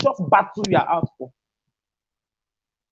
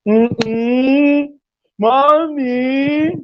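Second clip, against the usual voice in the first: put-on child whining; 2 semitones higher, much slower than usual.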